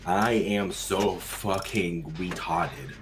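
very slow, stretched syllables; slow sounding-out. Pretend. Closing a read-aloud gag for friends, slow sounding-out.

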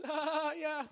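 put-on high, playful little-girl refusal; toddler voice. Daughter teasing dad from penguin tunnel, high little voice. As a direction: play the cheeky three-year-old.

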